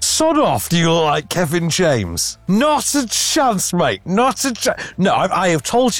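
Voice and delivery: high, slowed, playful indignant scoff; mock-indignant high-pitched dismissal of a friend's boast; mock outrage. Real, not acted. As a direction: scoff at the idea, half joking. Teasing his co-host's claim.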